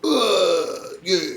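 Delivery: high, strained acted grunts; hammed-up high cry of struggle; mock fight grunts. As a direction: grunt like two fighters grappling. Voicing the two fighters grappling.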